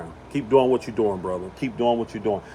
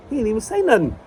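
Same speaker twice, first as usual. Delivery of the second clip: indignant; 8 semitones higher, faster than usual. Real, not acted. Defending Kyrie.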